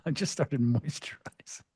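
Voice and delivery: whispered confession aside; sheepish whisper. Real, not acted. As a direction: lean in and whisper it.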